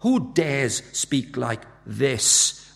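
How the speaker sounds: "Who dares speak like this" drawn out very slowly as a question; weighty, drawn-out rhetorical question; slow challenge. Acted. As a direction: stretch each word of "Who dares speak like this". Question set against its answer.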